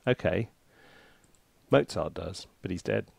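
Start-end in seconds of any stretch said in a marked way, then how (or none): none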